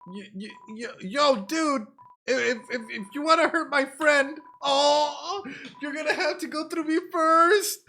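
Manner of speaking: high, squeaky stammer building to a loud stand; a scared kid finding courage; brave little monster kid. Acted. Monster Kid facing Undyne to protect a friend, high and loud.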